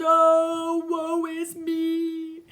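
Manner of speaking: high, slow wailing lament; ghostly wail. Acted. Ghost bewailing its doom, slow high wail. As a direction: draw out the woe.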